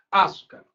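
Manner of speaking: "açúcar" with the opening A exaggerated; stress on first syllable. Shown as a demonstration. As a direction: punch the A that starts "açúcar", let the rest drop. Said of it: "sílaba tônica é o A".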